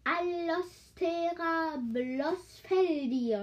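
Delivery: high, slow cry; high slow cry over her cactus. A narrator's reading. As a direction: cry it out, protecting the plant. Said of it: "rief sie".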